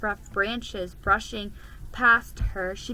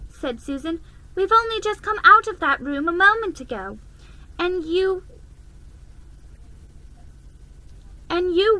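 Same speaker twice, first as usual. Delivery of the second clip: higher, louder fast scolding; an older sister dismissing a silly tale; big sister scolding; 8 semitones higher, 6 dB louder than usual. A narrator's reading. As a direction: tell her off briskly. Susan scolds Lucy.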